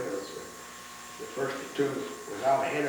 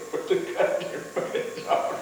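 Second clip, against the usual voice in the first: laughing; 5 semitones higher than usual. Real, not acted. Laughing while retelling order.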